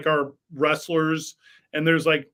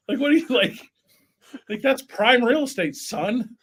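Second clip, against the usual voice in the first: mock tough guy; 8 semitones higher than usual. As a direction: brush him off like a tough old-timer. Mock scolding reply to wrestling fan.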